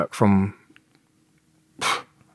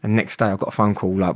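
ordinary talk, nothing marked

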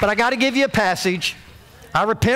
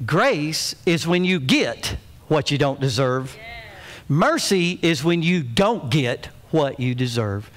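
ordinary talk

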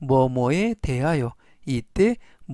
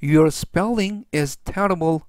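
slow model sentence; much slower than usual. Shown as a demonstration. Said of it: "전체적 의미는".

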